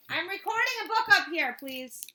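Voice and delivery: loud exasperated request. Real, not acted. Reader telling someone upstairs to hush, loud plea.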